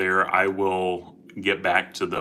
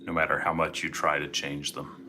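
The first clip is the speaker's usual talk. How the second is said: guided meditation; 7 dB quieter than usual. Acted. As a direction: gently guide listeners into calm.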